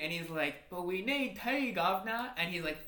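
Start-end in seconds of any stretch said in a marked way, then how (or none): 0.7-2.3 put-on British accent. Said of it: "the British were like".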